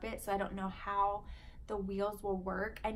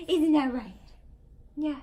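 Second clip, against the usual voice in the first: put-on baby talk; 6 semitones higher, 10 dB louder than usual. Mom cooing to baby, louder.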